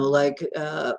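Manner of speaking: ordinary delivery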